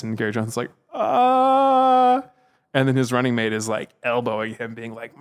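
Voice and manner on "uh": stalled high uh. Retold or imitated. Imitating Johnson stalling.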